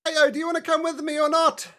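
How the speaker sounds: high, loud character voice calling to a companion; loud, high-pitched shouted question; Calistarin's voice. Acted. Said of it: "Calistarin says".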